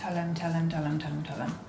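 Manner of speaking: a quiet, sinking chant that fades out; fading urge. Real, not acted. Reader urging the character, fading to a murmur.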